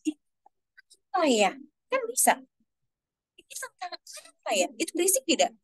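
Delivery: playful hushed wondering, pitch up; curious storyteller. Shown as a demonstration. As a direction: tease the children into curiosity. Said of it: "kita mulai dari bisik-bisik". (guru bercerita yang penasaran, suara dinaikkan)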